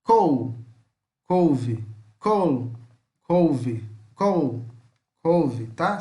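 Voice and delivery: "col" with a pulled L against "couve"; teacher contrasting "couve" and "col"; contrast drill. Shown as a demonstration. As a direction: alternate "couve" and "col", pull the L. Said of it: "tenta dar uma puxada nele".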